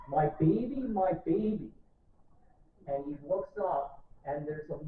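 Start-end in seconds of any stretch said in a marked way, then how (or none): 0.1-1.7 mild mock scream, a bit higher and louder; retold scream. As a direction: cry out like a desperate mother. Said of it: "a scream going".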